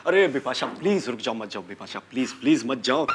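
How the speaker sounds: low, soft begging; pleading. Acted. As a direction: beg her gently not to leave. Husband begging wife to stay. (धीमी आवाज़ में मिन्नत करते हुए)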